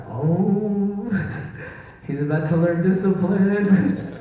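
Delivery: a hint of sibling mockery; teasing brothers. Brothers gloating as mom confronts [name], teasing. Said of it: "My brothers were like".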